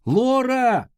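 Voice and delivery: high, drawn-out call of a name; shouting. A narrator's reading. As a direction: call out for someone missing. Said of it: "закричал Артур". (громкий протяжный крик)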